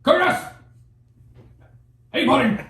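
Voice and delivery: high, loud holler of greeting; imitating a friend's big booming shout. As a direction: call out to a pal across the store. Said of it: "like, this loud, deep, booming voice".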